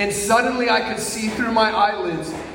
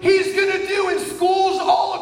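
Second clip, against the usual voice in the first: prophetic scream; 12 semitones higher than usual. Retold or imitated. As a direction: scream it out like a prophecy over the room. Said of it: "start screaming at the top of my lungs".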